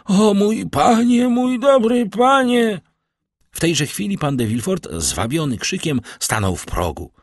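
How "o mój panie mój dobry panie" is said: much higher, louder, slow dying cry; a dying servant clinging to his master; anguished wail. A narrator's reading. The dying servant wails to his master at his knees. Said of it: "wołał".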